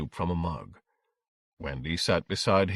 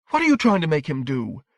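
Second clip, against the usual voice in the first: higher, indignant challenge; angry mother; 7 semitones higher, 4 dB louder than usual. A narrator's reading. Wife demanding what her husband is making their son do, angry. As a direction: confront him in a raised pitch. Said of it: "her face pale and angry".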